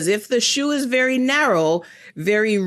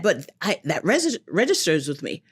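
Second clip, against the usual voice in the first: ordinary delivery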